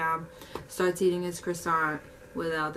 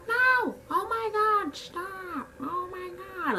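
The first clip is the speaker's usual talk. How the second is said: very high alarmed shrieks; old customers begging her dad to stop; panicked old people; 13 semitones higher, slower than usual. Retold or imitated.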